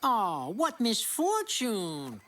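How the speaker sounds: slow, drawn-out show of sorrow; theatrical lament. Acted. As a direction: lament it grandly, stretching each word. Knight laments hearing parents are dead.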